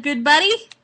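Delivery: ordinary talk, nothing marked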